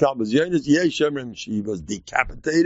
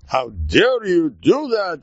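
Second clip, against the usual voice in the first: mock indignation, drawn out slowly; slow, high-pitched mock outrage; indignant outrage; 5 semitones higher, 4 dB louder, slower than usual. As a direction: act affronted, dragging out the words higher. Mocking an offended honor-seeker.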